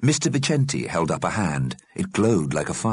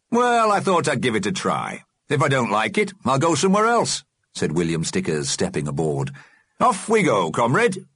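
put-on old trade unionist voice; 6 semitones higher than usual. William Stickers boards the boat.

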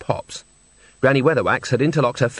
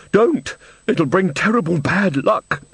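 high, drawn-out frightened warning; terrified; 7 semitones higher, slower than usual. A narrator's reading. Old woman warns Granny off the door, terrified. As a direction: plead in fear, higher than usual. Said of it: "The woman was clearly terrified".

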